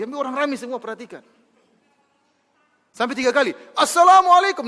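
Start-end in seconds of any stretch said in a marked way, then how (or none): 3.8-4.7 loud, high, ringing greeting; shouted greeting. Retold or imitated. Police chief greeting the idol, loud high shout. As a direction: bellow the salam across the temple. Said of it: "dengan suara yang keras".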